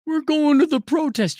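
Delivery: high whining fake cry; mocking a grad begging for a bailout; whiny mock young adult. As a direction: whimper it like a spoiled grad. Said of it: "What do you mean a student loan bailout?".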